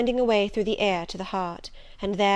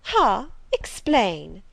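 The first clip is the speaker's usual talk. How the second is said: sharp, loud command; commanding lady; 7 dB louder, much slower than usual. A narrator's reading. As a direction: bark the order like a haughty lady. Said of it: "commanded the lady".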